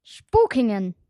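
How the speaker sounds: loud and forceful at last; blurted answer. Acted. Stammering girl finally blurting out her hometown, loudly. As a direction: spit the name out in one burst.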